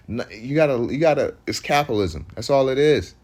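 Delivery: ordinary delivery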